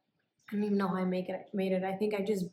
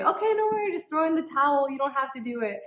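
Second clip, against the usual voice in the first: much higher, louder sing-song mom voice; imagined sweet mom; 6 semitones higher, 6 dB louder, faster than usual. Retold or imitated. Mom imagined saying you can quit, high and bright. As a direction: let her off the hook in a high gentle mom voice. Wished-for mom's permission.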